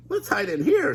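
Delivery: high scared Shaggy suggestion; Shaggy suggesting. Acted. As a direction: urge him to hide. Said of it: "Shaggy suggested".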